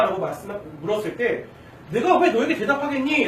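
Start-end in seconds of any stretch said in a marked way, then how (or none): 1.9-3.2 higher, louder testy retort; acted-out exasperation, voice raised; irritated. Jesus answering people who ask who he is, irritated. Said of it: "예수님이 짜증내시고 있는 거예요".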